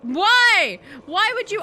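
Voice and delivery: high, loud panicked cry of no and why; shrieking in sudden fright; startled shriek. Real, not acted. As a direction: yelp in real fright at the jump scare. Zombie leaps from bathtub.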